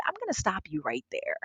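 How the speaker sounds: soft, firm interruption; quiet stern cut-off. Retold or imitated. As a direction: hush her and stop her mid-pitch. Comcast VP cuts her pitch short.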